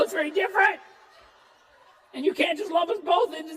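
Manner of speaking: frantic correction. Retold or imitated. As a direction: insist hard, like a kid correcting bullies. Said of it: "I'm like".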